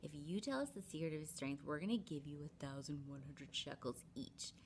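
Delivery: conspiratorial whisper; plotting kings whispering to Delilah. Retold or imitated. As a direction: hush it like a secret plot.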